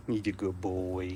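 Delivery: quiet, low cooing to a dog; soft murmur. Real, not acted. Praising dog softly.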